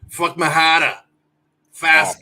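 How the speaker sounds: loud mock Boston accent. Co-host mocks the worst accent, loud Boston accent. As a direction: drop the Rs in a brash Boston accent. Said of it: "Jersey and Boston".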